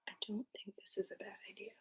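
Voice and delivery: quick faint whisper; barely audible whisper. Real, not acted. Keeps whispering in the room.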